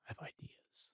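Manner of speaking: breathy whispered aside to himself. Retold or imitated. Narrating his silent reaction to the band, in a whisper. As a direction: whisper it like a secret thought.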